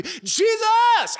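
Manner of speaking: loud, high-pitched shout over a crowd. Acted. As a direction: yell it out to be heard above the crowd. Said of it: "they just start shouting out".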